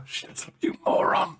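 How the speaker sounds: harsh whisper; second guard silencing the first in a whisper; whispered shush. Acted. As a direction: hiss it under your breath. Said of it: "And another voice go".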